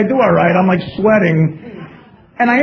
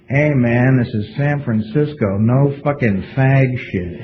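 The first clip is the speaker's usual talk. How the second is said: low, slow deadpan warning, much deeper; a lower mock Norm, dragging the last words; imitating Norm; 9 semitones lower, 3 dB quieter, slower than usual. Norm teases him backstage.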